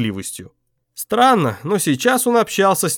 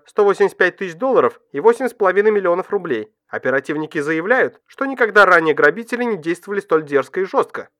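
higher, brisk newsreader delivery; news read; 7 semitones higher than usual. A narrator's reading.

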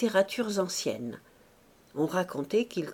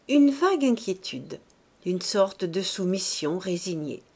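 ordinary delivery

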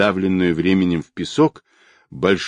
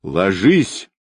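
plain reading voice

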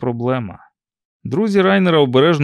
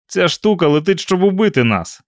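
raised, urgent pitch; urgent argument; 6 semitones higher, 4 dB louder than usual. A narrator's reading. Captive warns the others it flies to kill, urgent argument. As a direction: argue urgently, pitched high. Panicked argument over approaching aircraft.

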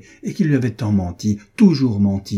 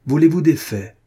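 higher, louder, biting question; bitter, forceful retort; harsh challenge; 5 semitones higher, 5 dB louder than usual. A narrator's reading. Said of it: "reprit-il plus âprement".

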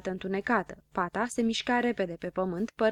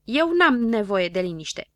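loud, raised-pitch retort; quarrelling; 4 semitones higher, 6 dB louder than usual. A narrator's reading. Don Dănel argues with Habar n-am, quarrelling. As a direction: argue back indignantly.

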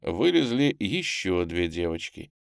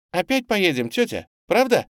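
put-on high, eager little boy; joyful exclamation; 11 semitones higher, 4 dB louder than usual. A narrator's reading. Small boy running up to Tamara, joyful high cry. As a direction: beg with delight. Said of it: "радостно вскрикнул и подбежал к Тамаре".

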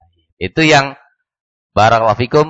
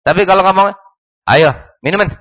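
threatening invitation, raised pitch; angry dare; 7 semitones higher, much faster than usual. Shown as a demonstration. Teacher showing permission that is really a threat, angrily daring. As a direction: say go ahead as a warning. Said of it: "marah gitu".

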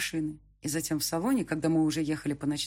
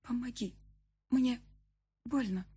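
put-on soft, higher voice of a hurt girl; weak pleading girl; 7 semitones higher, 3 dB quieter than usual. A narrator's reading. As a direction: beg for help in pain. Injured girl begs for help. (слабая мольба от боли)

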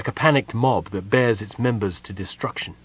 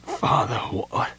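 weak words fading to unvoiced breath; dry-throated; 4 dB quieter, slower than usual. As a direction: force it out through a parched throat. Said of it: "His throat was so dry".

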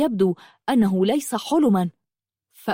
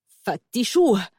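curt angry order; slower than usual. A narrator's reading. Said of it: "قالت غلاديولوس بعصبية شديدة".

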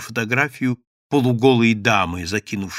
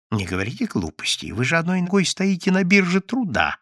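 hissing; 6 semitones higher than usual. A narrator's reading. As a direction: hiss it down at him. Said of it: "зашипел Евсей Львович".

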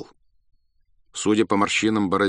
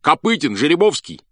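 higher, louder eager questions; eager guesses; 7 semitones higher, 6 dB louder than usual. A narrator's reading. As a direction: pitch the guesses hopefully. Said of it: "спрашивали у него".

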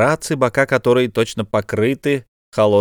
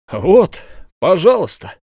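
sudden raised-voice exclamation; exclaiming cry; 8 semitones higher, 5 dB louder than usual. A narrator's reading. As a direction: cry out, high and loud. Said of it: "вскричал Сервадак".

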